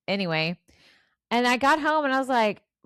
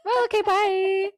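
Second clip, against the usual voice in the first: high, drawn-out goodbye; breezy mock farewell; 10 semitones higher, 3 dB louder, slower than usual. Co-host imitating Grams leaving frightened listeners, high sing-out farewell. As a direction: stretch it out high, as if leaving cheerfully.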